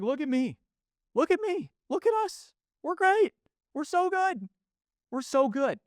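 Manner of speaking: very high, sing-song look-at-me; mock show-off. Podcaster mocking braggy businesses, squeaky high show-off. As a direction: preen like a company begging for praise. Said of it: "It's like".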